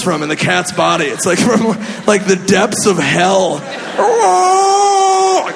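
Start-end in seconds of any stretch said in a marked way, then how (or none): 4.0-5.5 long high wailing cat yowl; cat yowl imitation. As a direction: wail like a cat from the depths of hell.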